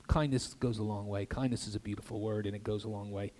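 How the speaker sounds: soft, low and tender; gentle sincerity. Real, not acted. Preacher reflecting on kindness to congregation, soft and sincere.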